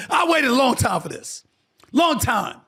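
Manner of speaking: proud Knicks fan finally vindicated; passionate outburst. Real, not acted. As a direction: let years of waiting pour out.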